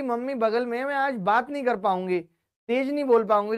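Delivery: ordinary talk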